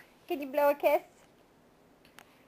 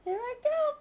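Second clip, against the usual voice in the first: teasing peekaboo build-up; playful building; 7 semitones higher, 7 dB quieter, faster than usual. Pretend. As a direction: build the suspense of a chase game. Peekaboo game, building each time.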